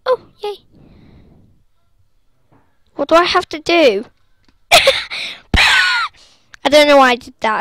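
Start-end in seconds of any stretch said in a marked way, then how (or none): 4.7-7.6 laughing. Real, not acted. Laughing after doing something silly.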